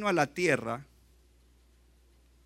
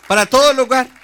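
much louder, higher, with "todo" punched; preacher's shouted emphasis; emphatic shout; 9 semitones higher, 14 dB louder than usual. Real, not acted. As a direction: shout it to the church. Preacher hammers home the point.

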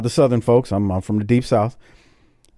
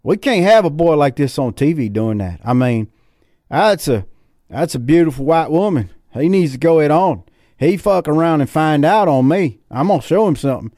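outraged Southern viewer; 4 semitones higher than usual. Retold or imitated. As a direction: ham up an angry Southern fan ready to grab his pistol. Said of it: "they're like".

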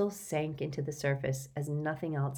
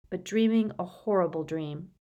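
plain reading voice

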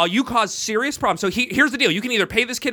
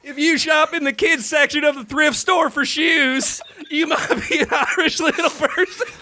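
put-on Southern accent; 6 semitones higher than usual. Comic giving the last running joke, laughing Southern accent. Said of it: "that was a Southern accent".

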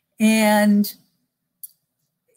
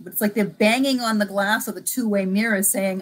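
normal talking voice

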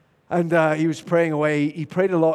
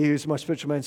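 ordinary talk, nothing marked

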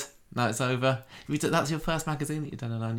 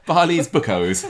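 laughing; 6 semitones higher, 7 dB louder, slower than usual. Real, not acted. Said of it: "laughing at slip".

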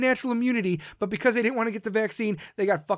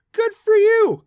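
"good for you" much higher and louder; emphatic shout; 11 semitones higher, 10 dB louder, slower than usual. Real, not acted. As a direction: belt out "good for you" as the punchline. Repeated good for you list.

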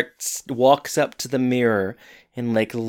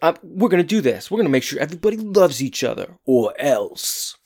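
high-pitched mock threat, dropping on "or else"; put-on bossy warning; mock-tough thug; 3 semitones higher than usual. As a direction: play a comically menacing enforcer of love. Voices mock threat of angry rave thugs.

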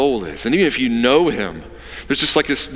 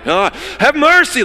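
much higher and louder yell; 5 dB louder than usual. Acted. Beggar shouting over the crowd to Jesus, loud yell. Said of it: "he's yelling at this point".

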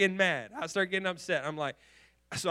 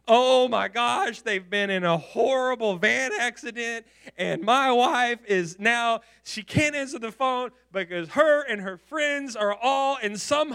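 louder, higher panicked imagining; husband picturing disaster, rising panic; freaking out; 4 semitones higher, 7 dB louder than usual. Retold or imitated. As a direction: spiral into panic about the accident. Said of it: "I am literally freaking out".

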